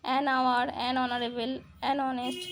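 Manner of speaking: "আওয়ার", "অনারেবল", "অনেস্ট" each starting on the vowel, H dropped; silent H. Shown as a demonstration. Teacher demonstrating exceptions, silent H in "আওয়ার", "অনারেবল", "অনেস্ট". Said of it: "এইচের উচ্চারণ এখানে হচ্ছে না".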